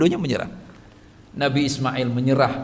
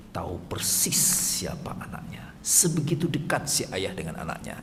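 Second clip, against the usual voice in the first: hushed whisper; 6 semitones lower, 7 dB quieter than usual. Acted. Preacher whispering to fathers about closeness with son. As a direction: drop to a whisper, lower.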